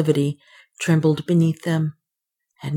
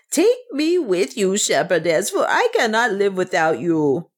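wailing; 3 semitones higher than usual. A narrator's reading. Servant begging not to be left, wailing. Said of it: "she wailed".

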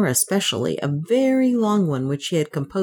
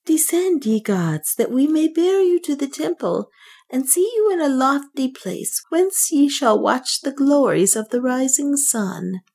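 high priest voice; 9 semitones higher than usual. A narrator's reading. Nam addresses the gods.

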